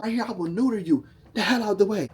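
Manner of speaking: high, loud angry man voice; threatening man. Retold or imitated. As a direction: bark it like an angry boss. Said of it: "I kid y'all not".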